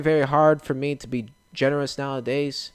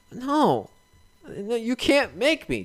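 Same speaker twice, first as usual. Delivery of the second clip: put-on childish refusal; 10 semitones higher, 3 dB quieter, slower than usual. [name] refusing in skit.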